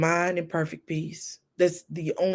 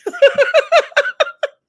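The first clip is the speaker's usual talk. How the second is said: high, loud burst of laughter; shrieking laugh; 9 dB louder than usual. Real, not acted. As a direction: crack up at the memory.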